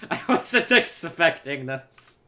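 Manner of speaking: slow, laugh-broken words. Real, not acted. Reacting to the narrator he wasn't expecting, laughing. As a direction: squeeze the words out through laughter.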